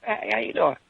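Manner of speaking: acted-out timid little hello; soft reply. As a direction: repeat it gently, keeping the peace. Repeats meek reply to screamer.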